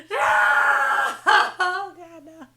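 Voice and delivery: loud, high mock wail; falling-out horror. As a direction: wail it out in hammed-up despair, then drop away. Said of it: "Y'all, I fell out".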